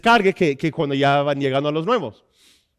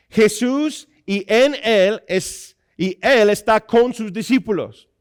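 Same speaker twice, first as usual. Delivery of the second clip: raised, forceful sermon voice; high-energy pulpit delivery; emphatic preaching; 6 semitones higher, 5 dB louder than usual. Acted. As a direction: proclaim it to the congregation, stretching key words.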